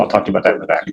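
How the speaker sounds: low, unvoiced aside to the bench; hushed aside. Real, not acted. Lawyer quietly tells the judge to talk in the back, hushed. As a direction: keep it private, almost a whisper.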